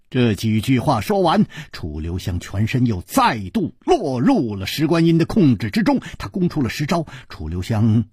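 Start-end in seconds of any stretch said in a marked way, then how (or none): none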